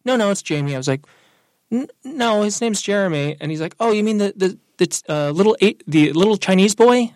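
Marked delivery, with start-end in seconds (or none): 0.0-0.8 imitating an insistent customer, pitched high; insistent correction. Customer insisting on a coworker's name, insistently. 1.7-3.4 high, halting retold correction; hesitant correction. Worker correcting a customer on a name, hesitantly insistent. 3.8-7.1 imitating a customer, high, loud and halting; groping clarification. Customer trying to identify the technician, loudly groping. Customer gropes for the offending description.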